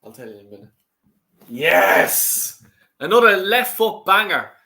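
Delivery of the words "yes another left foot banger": goal cheer. Real, not acted. Goal celebration while watching match.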